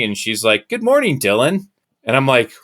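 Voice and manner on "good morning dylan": high, sing-song greeting of the university president; president's greeting. Retold or imitated. University president greeting the student at dawn, high and sing-song. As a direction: greet him sweetly like the president who knows him.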